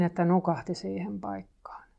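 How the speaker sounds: ordinary delivery, nothing marked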